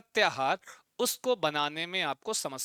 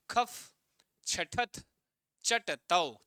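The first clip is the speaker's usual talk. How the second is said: each chunk said apart with pauses; split into three parts; much slower than usual. Shown as a demonstration. Teacher splitting a long sutra for students. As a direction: break the line into three bits. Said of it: "तीन बार में पढ़ो".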